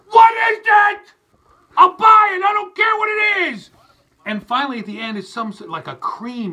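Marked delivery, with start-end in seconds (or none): 0.1-3.7 much louder and higher, slow desperate yelling; exasperated shout. Retold or imitated. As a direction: scream at the screen, begging. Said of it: "you're like".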